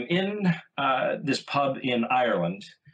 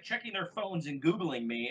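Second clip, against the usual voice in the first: usual speaking voice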